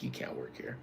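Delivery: imitating her, very soft and low; low mumbled retelling. Woman dismisses a worker, imitated very low and soft. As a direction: drop way down into a quiet mock dismissal.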